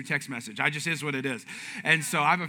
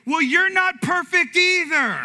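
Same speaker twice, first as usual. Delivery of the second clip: loud, high, slow mock retort; hammed-up aggrieved shout; indignant comeback; 11 semitones higher, 7 dB louder, slower than usual. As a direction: fire back like an offended husband. Acts out his offended text reply.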